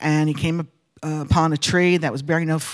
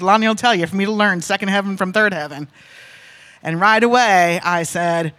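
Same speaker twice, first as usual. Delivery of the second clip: ordinary talk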